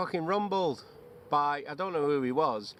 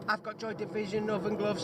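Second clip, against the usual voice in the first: demo line; 5 semitones higher than usual. Shown as a demonstration.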